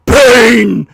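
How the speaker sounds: hugely loud, raspy drawn-out roar; exaggerated growling yell, held long; Batman. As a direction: bellow it as an over-the-top raspy superhero. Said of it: "raspier per movie".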